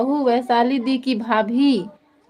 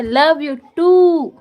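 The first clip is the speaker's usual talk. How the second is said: "Love you too" stretched very slowly, a bit louder, falling on "too"; drawn-out love you; 4 dB louder, much slower than usual. Retold or imitated. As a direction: draw out "too" lovingly. Reads a fond chat reply.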